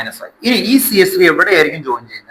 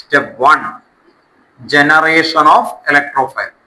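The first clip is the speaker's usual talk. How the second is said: very slow, each word spaced out; slow dictation; much slower than usual. Shown as a demonstration. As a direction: dictate slowly for writing. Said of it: "ആദ്യം എഴുതണം".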